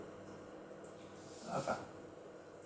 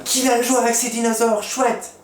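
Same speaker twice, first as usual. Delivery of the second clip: much higher, louder, delighted; super happy exclamation; 10 semitones higher, 5 dB louder than usual. Shown as a demonstration. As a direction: exclaim it with joy like Monsieur Exclama. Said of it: "Ce qui est super content ou qui s'exclame". (Monsieur Exclama, très content et enthousiaste)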